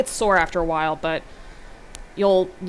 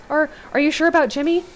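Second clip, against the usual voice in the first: shaken; 8 semitones higher, 6 dB louder than usual. Acted. Riley stammers, shocked.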